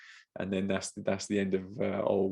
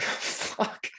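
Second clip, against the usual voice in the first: whispered curse; 3 dB quieter than usual. Retold or imitated. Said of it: "I'm like".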